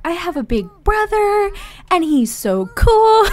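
put-on high, loud, gushing kid voice; excited little boy. Little brother telling his dad about his new big brother, gushing. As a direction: bounce off the walls like a thrilled kid. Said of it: "He's like".

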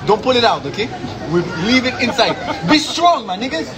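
high, pumped-up encouragement; encouraging. Real, not acted. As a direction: cheer them through it. Encouraging friends with sour candy.

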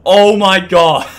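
high, loud, laughing shriek; shrieking jackpot winner. Real, not acted. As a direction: celebrate wildly with laughter. Jackpot win celebration, laughing.

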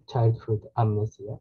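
usual speaking voice

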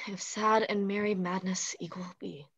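hushed whisper to herself; whispered aside. Acted. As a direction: breathe it as a secret aside. Olivia's aside after "I speak too loud.

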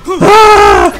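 huge high piercing scream of joy; excited scream. Real, not acted. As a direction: let out a full-throated shriek. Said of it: "I want to scream".

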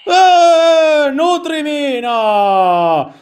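very high, long-held wail; demon child cry. Pretend. Demon child demanding to be fed, high drawn-out wail. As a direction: stretch the cry out, pitched far up.

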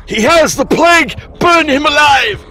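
high, shrill-edged mock panic of a crowd; mock outraged mob cry; panicked coworkers. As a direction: play the hysterical coworkers yelling. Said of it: "I'd have people going".

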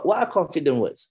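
normal talking voice

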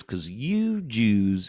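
very slow Southern drawl; Kentucky booker. Retold or imitated. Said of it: "and he goes".

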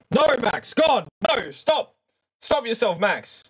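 high, hammed-up telling-off; playful high-pitched panic at your own words; mock self-scolding. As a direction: cut yourself off in mock horror.

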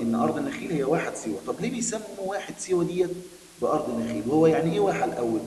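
normal talking voice

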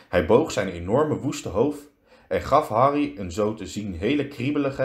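plain reading voice

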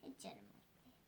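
whispered "Hiç yaramaz değil", no voice. Shown as a demonstration. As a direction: breathe "değil" softly. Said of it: "Hiç yaramaz değil ama".

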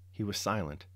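plain reading voice

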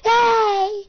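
high, very loud hey; mock shouted greeting. Walking up to someone, high mock shout. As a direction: belt out a cartoonish greeting. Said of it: "just walking there like".

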